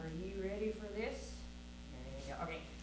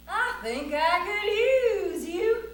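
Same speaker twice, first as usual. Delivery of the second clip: high, slow, louder realisation; dawning idea; 10 semitones higher, 11 dB louder, much slower than usual. Acted. Cowboy sees a use for the lion, dawning delight. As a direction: draw it out as the idea lands.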